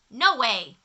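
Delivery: loud flat no; firm refusal. Acted. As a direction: shut it down hard. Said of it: "said Farmer Brown".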